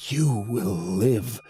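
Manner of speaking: slow, dragged-out promise; menacing creature. A narrator's reading. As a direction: stretch each word as it reaches for him. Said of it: "it said, reaching, straining".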